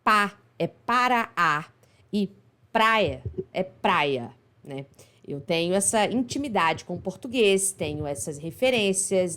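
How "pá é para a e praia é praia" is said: slow decoding. Shown as a demonstration. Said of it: "como falante do português brasileiro".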